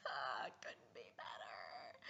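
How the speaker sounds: unvoiced, slow whisper; mortified whisper. Daughter answering mom, whispering in embarrassment. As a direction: mumble it, wanting to vanish.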